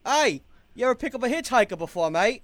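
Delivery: cheeky holler to a stranger; calling out. Acted. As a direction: hail the driver you are hanging off. Calls out to the driver in character.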